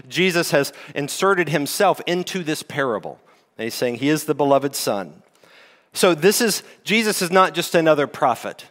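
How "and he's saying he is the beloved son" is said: usual speaking voice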